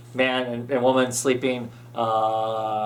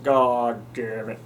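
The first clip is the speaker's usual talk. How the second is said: drawn-out, slow mock sloth; slow-motion grumble of a sloth; super slow sloth; 2 semitones lower, slower than usual. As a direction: stretch each word out lazily like a sloth. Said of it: "'cause they're going super slow".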